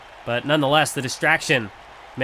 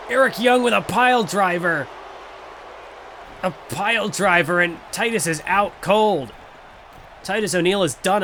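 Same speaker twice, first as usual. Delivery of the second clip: excited; 4 semitones higher than usual. Acted. Commentator calls the pile driver.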